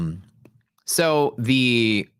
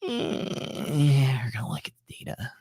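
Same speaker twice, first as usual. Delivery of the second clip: hushed, evasive whisper; whispered Powell impression; 2 semitones higher, 6 dB quieter than usual. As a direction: whisper it like a dodging Fed chair. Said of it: "He's gonna say".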